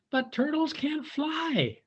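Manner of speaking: high and slow, patient bird; slow high bird correcting; hummingbird voice. A narrator's reading. Bird tells the turtle, high and slow. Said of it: "said the bird".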